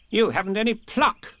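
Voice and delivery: high, tutting Rabbit; Rabbit, scornful. A narrator's reading. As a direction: scold Piglet briskly. Rabbit voiced.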